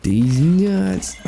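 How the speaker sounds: high, crowing punchline; gleeful punchline. Pretend. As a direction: crow it like a prank payoff.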